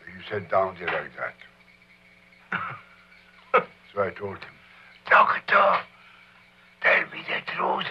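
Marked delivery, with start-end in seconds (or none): 5.1-7.9 high, loud mock-tragic plea; dramatic plea. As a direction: beg the doctor theatrically. Retold plea to the doctor.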